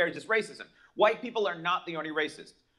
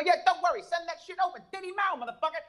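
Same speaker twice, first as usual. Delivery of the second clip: mock foreign voice; 11 semitones higher, faster than usual. Vietnam's reply voiced.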